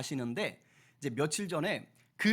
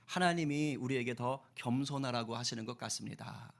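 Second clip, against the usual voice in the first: gentle Moody; 6 semitones lower, 6 dB quieter than usual. Retold or imitated. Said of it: "이렇게 말합니다".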